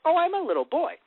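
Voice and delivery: put-on high, childlike little-boy voice. Showing how young Gohan talks, high little-boy voice. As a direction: pitch it way up like a small boy. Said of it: "like, to show, like".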